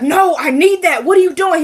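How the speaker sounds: desperately grab it back; frantic plea. Retold or imitated. Wyatt freaking out over drugs.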